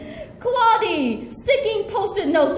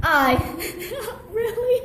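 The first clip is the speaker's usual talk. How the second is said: real laugh in disbelief; amused reader breaking into laughter; 4 semitones higher, 3 dB quieter than usual. Real, not acted. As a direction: crack up at the script.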